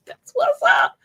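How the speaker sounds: way up high and bright; squeaky falsetto that's what's up; squeaky high catchphrase. Pretend. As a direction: squeal it like a cartoon.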